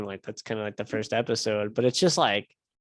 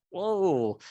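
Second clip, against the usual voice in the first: mock astonishment; 5 semitones higher than usual. Police finding the escaped man, retold.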